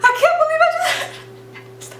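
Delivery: high, panicked gasps breaking off; mock anxiety attack. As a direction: act out panic tipping into tears. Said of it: "like, an anxiety attack".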